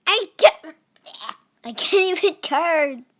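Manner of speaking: high, loud panicked cry; loud frantic complaint; frantic shout. Real, not acted. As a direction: yelp as the snake is stuck. Trapped in game.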